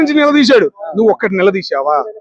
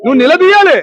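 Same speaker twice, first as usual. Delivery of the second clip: నిలదీయాలి hit higher and louder; louder repeat; 7 semitones higher, 4 dB louder than usual. Real, not acted. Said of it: "నేను గట్టిగా అరుతున్నా".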